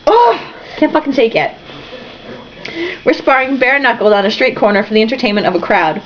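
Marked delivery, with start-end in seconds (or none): none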